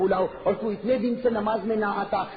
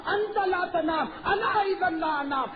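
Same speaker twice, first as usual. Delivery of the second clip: pleading Moses; 8 semitones higher, slower than usual. Acted. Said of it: "حضرت موسیٰ نے کہا".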